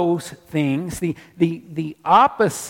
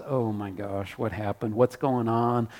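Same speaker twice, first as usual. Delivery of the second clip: imitating a panicked first thought, much lower and softer; mock panic, low and quiet; low panicked mutter; 8 semitones lower, 7 dB quieter than usual. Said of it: "Our first thought is".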